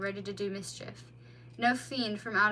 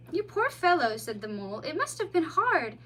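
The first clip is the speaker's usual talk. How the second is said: higher, louder worried voice; concerned mole; 5 semitones higher, 4 dB louder than usual. A narrator's reading. Mole to the bleeding otter, concerned. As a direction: fuss over the wounded child.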